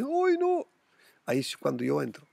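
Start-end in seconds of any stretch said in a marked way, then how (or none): 0.0-0.7 much higher hammed-up cry of dismay; mock wail. Vlogger imitating people crying over a falling coin, high mock wail. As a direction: whimper it like a crying loser. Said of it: "otra gente llora y dice".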